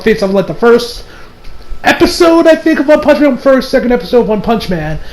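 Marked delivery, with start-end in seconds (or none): none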